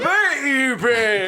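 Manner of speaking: loud, weird put-on call; Henry voice. Disguised character calling the enemy, loud weird voice. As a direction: holler in a strange comic disguise voice. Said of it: "why is your voice so weird".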